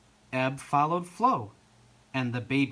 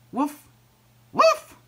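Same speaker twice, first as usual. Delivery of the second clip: high woofs, second one louder; louder dog bark; 10 dB louder than usual. A narrator's reading. Ebb insisting on help, barking louder. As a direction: build to a loud, high yap. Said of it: "barked Ebb again, even louder".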